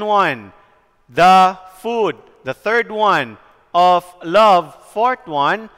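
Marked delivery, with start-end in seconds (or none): 1.1-2.2 "The food" with a loud, stressed FOOD; light "The", then punch "food" and let it fall; "The food" as a da-DUM foot; iambic pair. Shown as a demonstration. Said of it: "What are the pairs?". 3.7-4.7 "Of love" with LOVE hit loudly; light "Of", then punch "love" and let it fall; "Of love" as a da-DUM foot; iambic pair. Shown as a demonstration. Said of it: "What are the pairs?".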